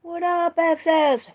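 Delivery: high, bright-pitched hype greeting to a viewer; loud greeting. Real, not acted. Greeting a viewer in the chat, high and loud. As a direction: call out hello with big energy.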